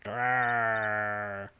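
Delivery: low, soft rumbling growl; tiger growl. Acted. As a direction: growl like a big cat lurking.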